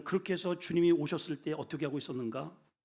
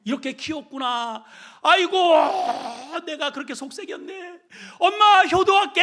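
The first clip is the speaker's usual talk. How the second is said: louder and higher, half laughing, ending in a shout; loud rueful cry; 11 semitones higher, 11 dB louder than usual. Acted. Grown child realizing to mother, loud laughing regret. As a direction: wail it out like a child who finally gets it.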